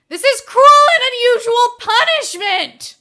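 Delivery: loud, high indignant yell; villain raging at prison guard; outraged shout. Acted. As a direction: protest at full volume.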